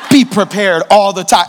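talking down to the cheater; smug classmate. Retold or imitated. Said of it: "And they're like".

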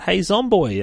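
mock yell. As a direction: shout it to get the zombie's attention. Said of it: "screams out".

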